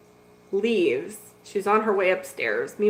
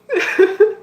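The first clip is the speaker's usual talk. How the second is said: burst of laughter; 11 semitones higher, 7 dB louder than usual. Real, not acted. Laughing after telling.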